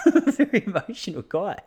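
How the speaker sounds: laughing self-mockery. Real, not acted. Laughing at himself.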